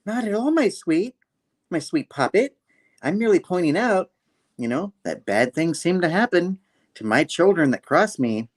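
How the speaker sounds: high, sing-song villainess mother; mock-sweet threat. Mother warning her sweet puppet, high and falsely sweet.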